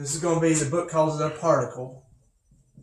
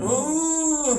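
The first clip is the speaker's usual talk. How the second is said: high cooing sound at a pet; 10 semitones higher than usual. Real, not acted. Teacher cooing at his dog, a high ooh.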